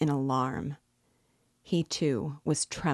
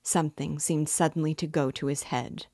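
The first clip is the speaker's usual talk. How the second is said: plain reading voice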